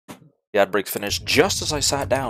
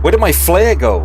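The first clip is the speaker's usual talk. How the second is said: frantic; 8 semitones higher, 10 dB louder than usual. Real, not acted. Frantic search mid fight.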